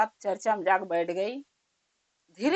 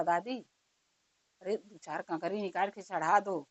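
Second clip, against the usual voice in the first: whispered plea; 5 semitones lower, 9 dB quieter than usual. A narrator's reading. Boy whispers to grandmother in assembly.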